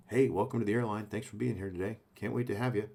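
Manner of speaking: hint of a captain welcoming passengers; mild airline captain. Captain welcoming passengers aboard, softly. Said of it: "the captain is sitting at the front welcoming people".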